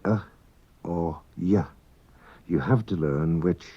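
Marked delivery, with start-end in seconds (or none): 0.0-1.6 "uh", then the alternative "y", as separate sounds; EY ending sounds. Shown as a demonstration. Teacher shows learners how Manx EY is pronounced, demonstrating both sounds. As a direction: give "uh" and "y" as two short, separate endings. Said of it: "This is pronounced uh".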